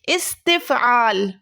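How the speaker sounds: استفعال drawn out slowly, syllable by syllable; slow dictation. Shown as a demonstration. Teacher introducing the last verb, slowly dictating. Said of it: "That is استفعال۔".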